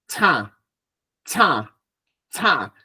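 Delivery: CHA with air through mouth and nose; nasalised "cha" syllable, drawn out; nasal cha. Shown as a demonstration. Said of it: "Es nasal".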